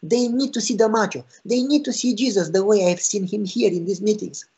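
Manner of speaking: high, insistent retold urgency; fervent plea. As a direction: plead for his friends. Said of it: "he retells the friend's plea".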